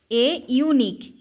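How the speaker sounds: usual speaking voice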